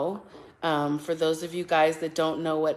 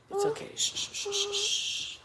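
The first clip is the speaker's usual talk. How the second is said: rhythmic shushing; 8 dB quieter, slower than usual. Real, not acted. Hushing baby to sleep.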